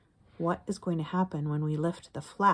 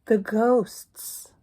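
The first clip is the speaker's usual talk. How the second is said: higher, louder sing-song read-aloud; higher, slower storytime chant; reading to children; 9 semitones higher, 6 dB louder, slower than usual. Acted. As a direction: chant the refrain for little listeners. Reading rhyming picture book aloud.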